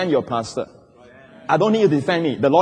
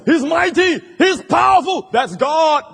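high, shouted proclamation; big pulpit exclamation; booming acclamation; 10 semitones higher, slower than usual. Acted. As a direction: shout God's might to the crowd. Voices awed view of mighty God.

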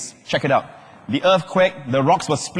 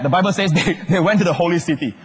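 ordinary talk

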